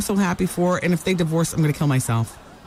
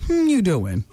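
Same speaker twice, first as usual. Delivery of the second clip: Joey catchphrase; 5 semitones higher, slower than usual. Pretend. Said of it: "Don't formalize it, Taryn. We should just say it".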